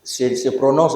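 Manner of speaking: usual speaking voice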